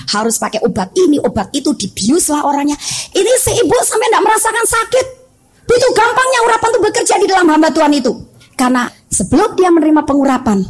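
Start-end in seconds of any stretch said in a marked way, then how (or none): 3.1-8.1 high-pitched, amazed preaching; berseru takjub. Real, not acted. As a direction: exclaim it up high, marvelling. Preacher exclaims over an effortless miracle. (berseru kagum dengan nada tinggi)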